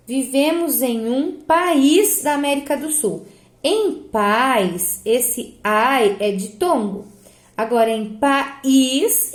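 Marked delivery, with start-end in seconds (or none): 1.4-2.2 "país" as pa-ÍS, two syllables; hiatus in país. Shown as a demonstration. Teacher reading the contrasting example, stressed second syllable. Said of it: "ele se separa". 8.2-9.3 "país" slowly as PA... ÍS; separated país. Shown as a demonstration. Teacher showing pupils the hiatus, two separate syllables. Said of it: "ele se separa".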